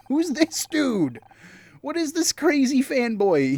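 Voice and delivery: high, drawn-out incredulous question; mock astonishment. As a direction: stretch it out high, baffled and amused. Puzzled high mock reaction to a character.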